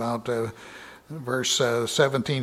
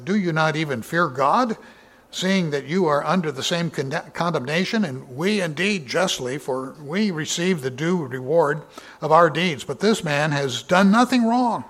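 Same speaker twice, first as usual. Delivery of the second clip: raised, declaiming Bible reading voice; lifted pulpit reading, a touch louder; scripture reading; 3 semitones higher, 4 dB louder than usual. Acted. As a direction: proclaim the verse to the congregation. Reading the criminal's rebuke.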